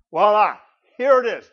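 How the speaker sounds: loud, high "voila" flourish; loud showman's unveiling; triumphant reveal. Acted. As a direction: present the answer with a flourish. Triumphant reveal of proof text.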